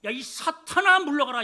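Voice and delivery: loud, cutting rebuke shouted at Peter; harsh rebuke. Retold or imitated. Jesus rebuking Peter, imitating a loud harsh rebuke. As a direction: bark the rebuke like a stern master. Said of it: "주님이 독설을 퍼부시는 거예요".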